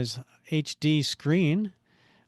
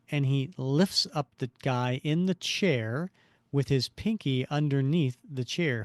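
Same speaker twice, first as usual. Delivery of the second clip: ordinary delivery, nothing marked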